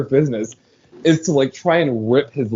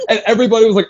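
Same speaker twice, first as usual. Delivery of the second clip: usual speaking voice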